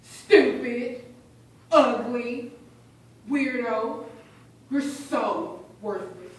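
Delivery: loud, punched insults, one at a time; name-calling taunts. Acted. Bully calls the victim names, loud jeering taunts. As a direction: hurl each insult forcefully, easing off on the last. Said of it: "it was just name calling".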